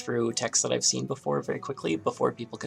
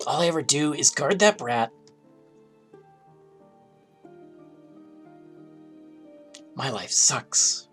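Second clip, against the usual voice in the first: dragon voice; 3 semitones higher, 4 dB louder than usual. Acted. Plays dragon character in game.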